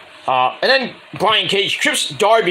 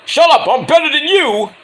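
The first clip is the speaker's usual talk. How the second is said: a hammed-up higher, louder boast; mock taunting champ; 9 semitones higher, 4 dB louder than usual. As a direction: sneer it like a cocky heel.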